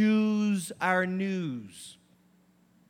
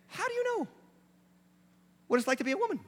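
incredulous squeak; 11 semitones higher, 5 dB quieter, much faster than usual. Acted. Incredulous question.

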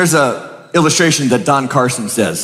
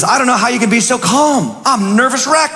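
nervous Smith; 8 semitones higher than usual. Retold or imitated. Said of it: "Smith says".

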